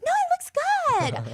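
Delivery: high-pitched, drawn-out fake praise; mock reassurance. Friend insisting a bad painting is fine, mock reassurance. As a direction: stretch it out high, placating and overdone.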